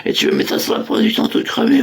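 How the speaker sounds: high, fast put-on angry producer; mock producer. The tied-up producer complains about the joke, high and fast. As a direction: rant like the annoyed producer. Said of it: "il fait".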